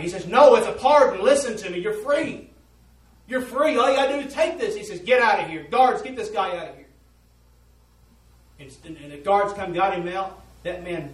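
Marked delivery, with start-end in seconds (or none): 0.3-4.7 high, loud, urgent pleading; pleading shout. Retold or imitated. Messenger pleads loudly. 5.0-6.9 shouting for the guards; loud dismissal. Retold or imitated. Prisoner calls guards.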